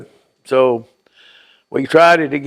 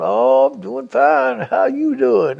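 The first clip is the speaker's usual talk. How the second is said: slow, raised, easygoing reply; innocent neighbour; 9 semitones higher, slower than usual. Retold or imitated. Neighbour answering the father casually, slow and lightly raised. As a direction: play it innocent and unhurried.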